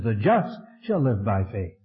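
"just" leaned on, the rest of the line light; stress on JUST. Shown as a demonstration. Preacher showing Paul's Romans reading, weight on "just". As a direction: punch the word "just" and let the rest follow. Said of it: "he put the emphasis on the just".

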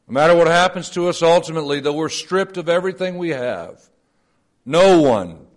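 fast, loud, climbing sermon cry; fervent preaching. Acted. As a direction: hammer it home with rising force. Climax of sermon point.

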